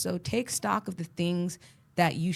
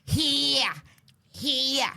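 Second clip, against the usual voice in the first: very high, loud, hammed-up Aussie; Australian accent; 10 semitones higher, 5 dB louder, much slower than usual. Answering co-host's teasing, exaggerated Australian accent. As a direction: go over the top in mock Australian, stretching the last words. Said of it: "without your Australian accent".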